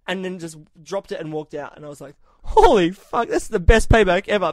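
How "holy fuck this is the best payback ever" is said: mock outburst. Catheter ripped out, retold reaction.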